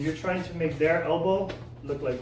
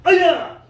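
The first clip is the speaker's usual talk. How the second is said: very loud piercing high yell; kiai shout; 14 semitones higher, 14 dB louder than usual. Shown as a demonstration. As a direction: explode the yell on the break. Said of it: "warning key up".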